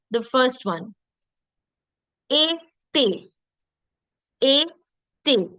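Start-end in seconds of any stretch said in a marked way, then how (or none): 2.3-5.5 "A, te" with both vowels as "ay"; two separate syllables, "A" then "te" as "ay-tay"; accented E sound. Shown as a demonstration. Teacher modelling été syllable by syllable, with "A, te" as "ay-tay". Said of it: "the A sound becomes A sound".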